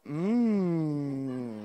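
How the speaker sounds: low, drawn-out craving mm; toddler's hum. Retold or imitated. Toddler in the car seat begging, long low mm. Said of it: "And she'd go".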